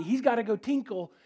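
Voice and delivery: normal talking voice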